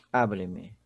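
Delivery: low "Abre-me", A strongest, then falling away; stress on first syllable. Shown as a demonstration. Teacher repeating the joined word for students, "Abre-me" stressed on A. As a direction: say "Abre-me" low, leaning only on the opening A. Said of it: "a sílaba tônica é o A".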